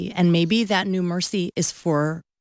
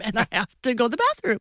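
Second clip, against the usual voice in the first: ordinary talk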